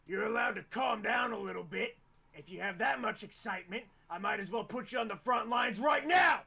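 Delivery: stern reprimand. Acted.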